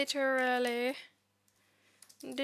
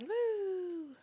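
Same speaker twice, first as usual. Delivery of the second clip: excited cheer; 7 semitones higher than usual. Real, not acted. Cheer as code reruns.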